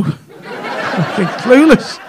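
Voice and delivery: normal talking voice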